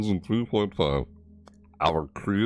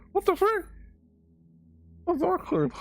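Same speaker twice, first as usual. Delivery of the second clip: pitch-shifted glitch. Real, not acted. Streamer startled by his own glitching mic, squeaky high voice. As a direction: voice suddenly squeaky and high like a sped-up tape.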